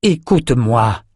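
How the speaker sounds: slow, drawn-out plea; imploring. A narrator's reading. Richard backs away from his attacker, imploring slowly. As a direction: beg to be heard, stretching the words. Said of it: "implora Richard".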